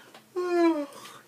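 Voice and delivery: high, loud, drawn-out lazy sigh. Pretend. Teacher showing laziness to students, a long mock sigh. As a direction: sigh as if you can't be bothered. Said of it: "how I feel when I'm lazy".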